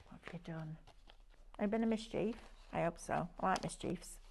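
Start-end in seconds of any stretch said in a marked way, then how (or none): 0.1-0.8 much softer, back down in pitch; hushed teasing question. Pretend. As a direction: ask it low and quiet, as an aside. Repeats the teasing question low.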